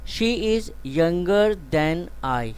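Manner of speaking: very slow, clear classroom reading; slow example sentence. Shown as a demonstration. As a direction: read it slowly so students can write. Teacher reads example sentence.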